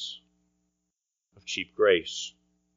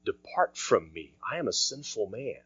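hushed Peter; 11 dB quieter than usual. Retold or imitated. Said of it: "what did Peter respond?".

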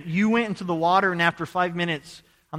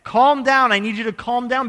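raised, louder voice, urgent commands; retold yelling; 5 semitones higher, 7 dB louder than usual. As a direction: call out firmly to a panicking swimmer. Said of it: "I kept yelling at him".

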